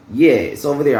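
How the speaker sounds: ordinary talk, nothing marked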